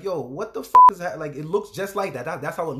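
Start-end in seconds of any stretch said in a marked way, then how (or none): 0.0-1.2 loud shocked yell; shocked shout. Retold or imitated. Seeing her crying on webcam, shocked loud yell. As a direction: yell it out at the screen. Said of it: "I'm just like".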